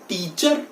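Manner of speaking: टीचर as tee-char with the r sounded; wrong pronunciation. Shown as a demonstration. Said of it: "that is wrong". (टीचर का गलत उच्चारण, अंत में र)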